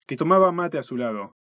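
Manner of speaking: usual speaking voice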